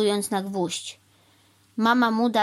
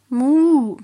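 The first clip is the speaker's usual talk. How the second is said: loud, raised, drawn-out moo; cow moo; 6 semitones higher, 9 dB louder than usual. A narrator's reading. Cow brushing off the crow's worry, loud moo. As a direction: moo out before speaking.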